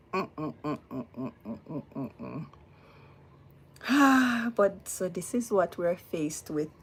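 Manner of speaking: ordinary delivery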